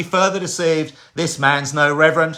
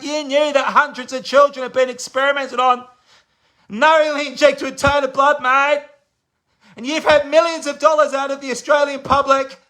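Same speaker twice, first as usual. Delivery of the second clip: raised, high-pitched angry rebuke; impassioned accusation; 7 semitones higher than usual. Real, not acted. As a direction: confront a mate who betrayed you, voice pitched right up. Accusing Bill of betrayal for money.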